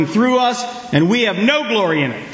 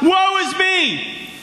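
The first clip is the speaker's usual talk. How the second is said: high, slow anguished cry of woe; 11 semitones higher, much slower than usual. A narrator's reading. The preacher voices Isaiah crying out before the Lord, high and slow. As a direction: wail it out like a man undone before God. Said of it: "he cried out".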